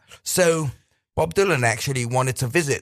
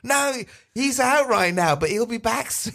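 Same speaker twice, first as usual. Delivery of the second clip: put-on high, quavering little old lady; 9 semitones higher than usual. Friend voices an old lady inviting Dylan in, high sweet old lady voice. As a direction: play the sweet old mum at the door. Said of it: "She goes".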